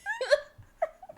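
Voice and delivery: a squealing high laugh; high crying laugh. Real, not acted. Laughing at her friend's scare, high squeal. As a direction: let out a high tearful laugh. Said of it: "I'm, like, crying".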